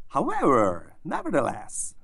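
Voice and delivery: mock praise in a lifted voice; praising intonation. Shown as a demonstration. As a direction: praise a dog with empty words. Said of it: "in a praising intonation".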